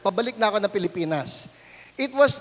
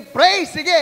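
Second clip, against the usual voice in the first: shouted, higher; loud eager shout; 6 semitones higher, 8 dB louder than usual. Retold or imitated. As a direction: yell it with glee. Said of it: "lakas ng sigaw niya".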